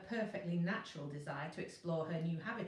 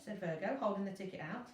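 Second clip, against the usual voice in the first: ordinary delivery, nothing marked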